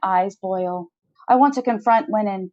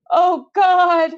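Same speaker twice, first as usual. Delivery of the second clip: 1 panicked cry; 10 semitones higher, 4 dB louder than usual. A narrator's reading.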